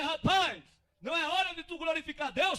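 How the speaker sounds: bronca forte e mais aguda; repreensão em voz alta. Acted. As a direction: interrompa o homem com uma bronca. Repreende o homem que glorifica. (repreendendo alto)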